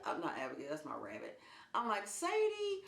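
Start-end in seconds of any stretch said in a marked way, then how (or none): 2.1-2.8 very high cooing reassurance; coaxing pet voice. Retold or imitated. Owner reaching up to her cat, high cooing. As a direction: soothe a nervous cat in a high little voice.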